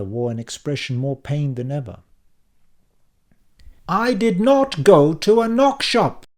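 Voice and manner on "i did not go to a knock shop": loud, slow, high explosion; yelling denial. A narrator's reading. Dan yelling at his family that he's innocent. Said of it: "I continued yelling".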